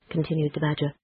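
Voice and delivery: ordinary delivery, nothing marked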